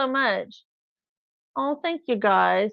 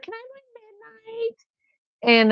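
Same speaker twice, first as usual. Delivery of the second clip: put-on pleading little girl; 13 semitones higher than usual. I was just a child.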